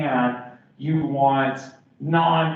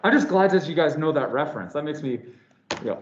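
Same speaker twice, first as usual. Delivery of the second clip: usual speaking voice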